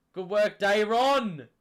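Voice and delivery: loud, slow, hyped praise; excited cheer. Real, not acted. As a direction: shout the praise out. Cheering a teammate.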